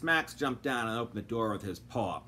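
plain reading voice

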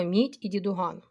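usual speaking voice

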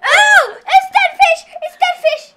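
loud, high and thrilled; excited shout. Real, not acted. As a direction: yell it out in triumph. Guessing the jelly bean flavour.

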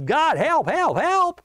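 hammed-up plea, pitch leaping up; crying out for help. Jehoshaphat under attack cries to God, mock-panicked. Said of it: "Jehoshaphat cries out".

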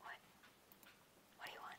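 hushed whisper. Real, not acted. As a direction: whisper the question. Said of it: "'Cause you just whispered to me".

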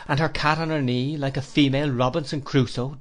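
ordinary delivery

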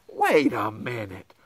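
plain reading voice

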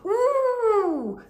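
high excited piggy squeal; high squeal of delight. A narrator's reading. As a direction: squeal it like a delighted little pig. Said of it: "squealed the second little pig".

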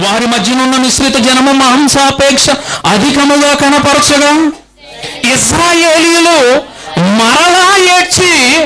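slow, solemn Bible reading, dragging the last words; scripture reading. Shown as a demonstration. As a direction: read the verse aloud like scripture. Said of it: "సంఖ్యాకాండం పదకొండవ అధ్యాయం నాలుగో వచనం". (నెమ్మదిగా గంభీరమైన వాక్య పఠనం)

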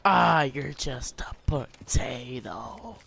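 slow whispered teasing; taunting whisper. Pretend. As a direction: breathe the insult in a slow whisper.

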